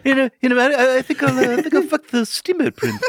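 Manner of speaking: high, laughing mock voice. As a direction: stay in the teasing voice. Keeps the mock voice.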